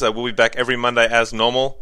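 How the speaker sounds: ordinary delivery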